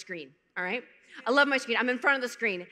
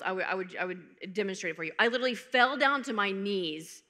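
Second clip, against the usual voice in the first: usual speaking voice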